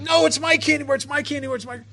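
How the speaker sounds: put-on high-pitched child cutting in with a protest; stubborn little girl. Little girl interrupting the paramedic over her candy bar, high stubborn protest. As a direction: insist like a little kid, words run on repeatedly.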